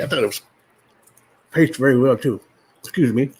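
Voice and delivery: talking through a mouthful of popcorn; words round a bite of popcorn; mouth full. Real, not acted. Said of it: "don't talk with a mouthful".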